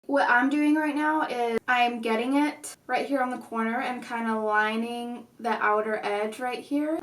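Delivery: ordinary talk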